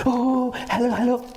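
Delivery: very high, gushing "Oh, hello, hello"; high sugary hello; overjoyed fake greeting. Shown as a demonstration. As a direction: squeal it out like an overeager greeter. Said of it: "No, don't say hello".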